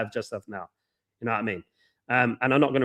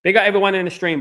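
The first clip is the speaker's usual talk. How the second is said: excited shout-out; 8 semitones higher, 7 dB louder than usual. Real, not acted. Shout-out to stream viewers.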